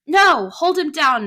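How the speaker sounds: high, loud, slow shout. Acted. Father struggling with Jim, loud shout. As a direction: bellow the order. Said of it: "my dad shouted".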